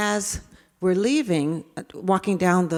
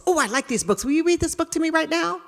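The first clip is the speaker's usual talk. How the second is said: put-on higher, fast excited kid begging; eager child; 9 semitones higher, faster than usual. David begging the teacher to read, higher and fast. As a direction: rush it like a boy who wants a story now. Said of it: "ran over to where we keep books".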